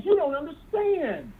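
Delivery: ordinary talk, nothing marked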